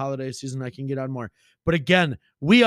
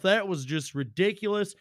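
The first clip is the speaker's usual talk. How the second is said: ordinary delivery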